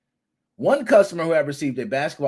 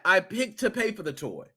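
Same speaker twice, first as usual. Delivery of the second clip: normal talking voice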